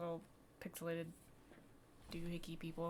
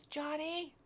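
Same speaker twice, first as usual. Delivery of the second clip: high, raised calling voice; yelling; 9 semitones higher, 8 dB louder than usual. Acted. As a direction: call out for a child from afar. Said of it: "somebody yells".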